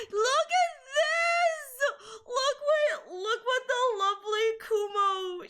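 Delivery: high, gushing squeal; excited squeal. Real, not acted. Streamer showing chat Kumo's drawing, excited squeal. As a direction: show it off, overcome with delight. Showing off beloved fan art.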